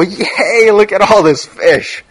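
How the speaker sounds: high, bright-eyed hammed-up cheer over the catch; mock excitement. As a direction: play it as a giddy fisherman jumping up and down. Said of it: "he wasn't excited at all".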